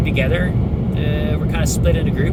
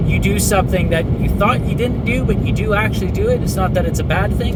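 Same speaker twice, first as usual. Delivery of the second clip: normal talking voice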